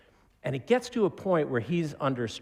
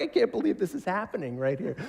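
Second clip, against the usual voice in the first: high, amazed; mock disbelief; 7 semitones higher than usual. Preacher recalls watching the show, in high disbelief. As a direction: gasp it out in disbelief. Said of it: "I was like".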